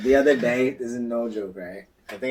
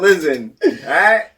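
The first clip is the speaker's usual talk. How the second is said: mock lecture; 7 semitones higher, 11 dB louder, slower than usual. Lecturing the ghost.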